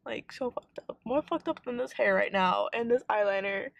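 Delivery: normal talking voice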